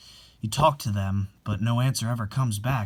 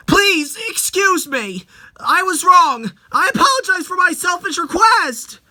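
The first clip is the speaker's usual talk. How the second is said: much higher and louder, desperate; frantic apology; 9 dB louder than usual. Acted. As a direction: beg forgiveness in a panic.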